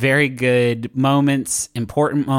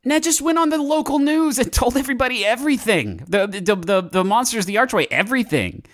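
high, panicky blurting; stammering, high-pitched alarm; Jake Cool; 10 semitones higher than usual. Acted. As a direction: spill the news in a high, worked-up voice. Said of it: "Jake Cool, I say".

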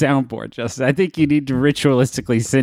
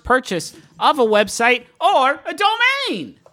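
high drawn-out ending; 12 semitones higher, slower than usual. Pretend. Ad read ending dramatically.

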